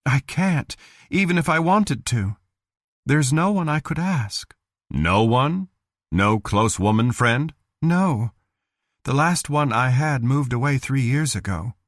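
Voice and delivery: lonely woman. Acted. Cheryl telling Bill she has no friend to stay with, resigned. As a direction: admit she has no one to turn to.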